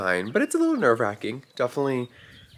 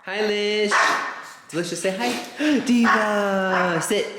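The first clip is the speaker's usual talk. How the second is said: greeting dogs; 11 semitones higher than usual. Real, not acted. Owner saying hi to each dog, high cooing. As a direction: sing out each dog's name up high.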